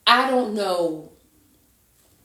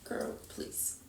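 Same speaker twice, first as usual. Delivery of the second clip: soft breathy whisper, barely voiced; whispered aside; 17 dB quieter than usual. Real, not acted. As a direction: drop to a whisper, half amused. Quiet aside after laughing.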